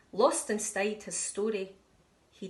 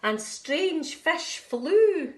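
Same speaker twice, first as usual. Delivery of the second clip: slow wondering narration; 5 dB louder, much slower than usual. A narrator's reading. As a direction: stretch it out full of wonder. Narrator evokes eerie ocean.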